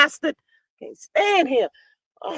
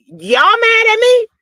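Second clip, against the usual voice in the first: mock indignant; 8 semitones higher than usual. Woman defending herself over married man, mock indignant. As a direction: whine it as if wrongly blamed.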